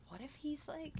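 whispering. Real, not acted. Whispered spooky suggestion.

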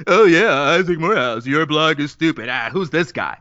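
lightly put-on dopey voice for a critic; silly mocking voice. Shown as a demonstration. Blogger replays a hurtful comment to dismiss it, lightly silly mocking voice. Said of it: "took a voice that you associate with silliness".

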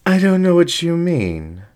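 high, coy fib with a smile; lying with a smile. Acted. Fox feigns innocence to the dingo, high and coy. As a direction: play innocent, smiling. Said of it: "the fox lied with a smile".

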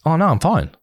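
high-pitched imitation of a denial; breezy denial. Partner denying she was upset, imitated breezy denial.

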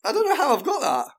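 amazed at yourself, voice pitched well up; astonished disbelief. Real, not acted. Contestant who guessed the slang right, astonished at himself.

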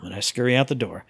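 ordinary talk

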